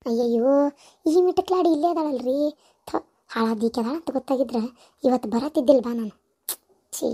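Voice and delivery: disgusted grumble. Acted. In-law spits at the hated girl.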